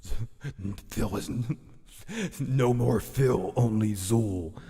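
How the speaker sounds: slow, demonic possession voice; low, otherworldly inner demon; deep, horrible voice. Acted. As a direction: draw it out like an evil spirit taking over. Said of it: "that deep, horrible".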